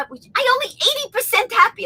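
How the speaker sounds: very high mock Japanese accent. Japanese passenger complaining in the sub, high Japanese accent. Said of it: "the heavy Japanese accent".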